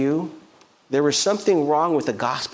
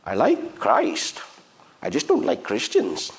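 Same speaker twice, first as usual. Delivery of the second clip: Gandhi. Retold or imitated. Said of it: "he said it in this tone, in this accent".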